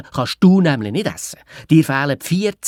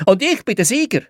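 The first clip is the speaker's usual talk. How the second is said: gerufen; 9 semitones higher, 3 dB louder than usual. Acted. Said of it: "habe ich aus dem Gäste-W C gerufen".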